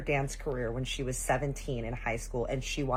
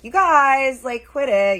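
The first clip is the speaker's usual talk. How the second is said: loud, high, drawn-out call; pretend scolding; 11 semitones higher, 13 dB louder, slower than usual. Auntie faking a telling-off of wrestling boys, loud whine. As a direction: put on a show of breaking it up.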